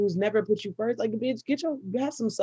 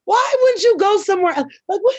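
loud, high, heated ranting; shrill-edged rant of disbelief at the driver; 11 dB louder than usual. Retold or imitated. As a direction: let the outrage boil over, high and loud. Ranting about the parked car.

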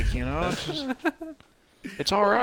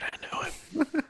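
whispering; 6 dB quieter, faster than usual. Real, not acted. Muttered aside after insult.

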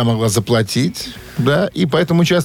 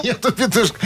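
laughing; 9 semitones higher than usual. Real, not acted. Repeats caller's line while laughing.

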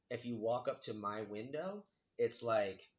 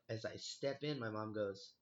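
usual speaking voice

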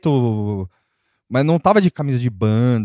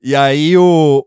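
normal talking voice

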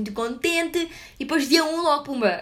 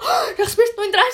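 worried mother; 10 semitones higher, 7 dB louder than usual. Retold or imitated. Mother misreads the crying.

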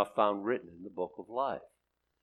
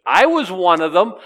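emphatic exclamation; 7 semitones higher, 14 dB louder than usual. Real, not acted. Emphatic personal confession.

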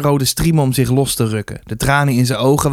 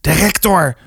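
urgent, higher hiss; hissed warning; 5 semitones higher than usual. A narrator's reading. Boy warning his mates the rector is here, hissed. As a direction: hiss it as an alarm. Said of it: "hoorde Arnold sissen".

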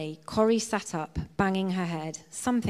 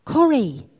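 loud, higher call of the name; expectant prod; 6 semitones higher, 11 dB louder than usual. A narrator's reading. Betsy nudges her sister to give thanks, expectant prod. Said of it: "looked at her sister expectantly and prodded".

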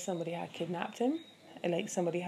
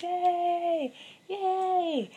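high, drawn-out yay; celebratory cheer; 12 semitones higher, 4 dB louder than usual. Pretend. Podcaster congratulating herself and listeners, high celebratory cheer. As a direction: squeal a little cheer for yourselves.

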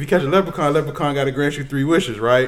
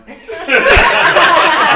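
big laugh; 14 semitones higher, 9 dB louder than usual. Real, not acted. Laughs after punchline.

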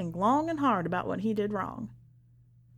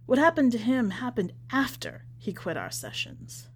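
usual speaking voice

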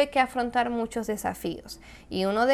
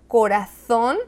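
corazón ending in a Castilian th, koɾaθon; Castilian Spanish corazón; Spain accent; 6 dB louder, slower than usual. Shown as a demonstration. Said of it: "O por ejemplo".

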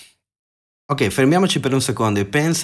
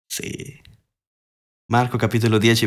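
ordinary talk, nothing marked

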